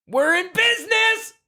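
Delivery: high, slow triumphant scream; guy screaming. Acted. Repeating the quote on request, high slow scream. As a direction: scream it again for the friend. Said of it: "Do it one more time".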